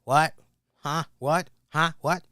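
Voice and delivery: loud, repeated huh what of a man who can't hear; loud imitating a hard-of-hearing father-in-law; mock deaf old man. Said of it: "whenever he goes".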